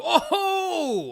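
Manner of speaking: high startled yelp; imitating a startled driver; retold shocked cry. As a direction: yelp as something hits the car. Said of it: "him go".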